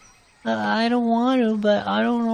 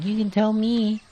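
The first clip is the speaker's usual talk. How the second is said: ordinary delivery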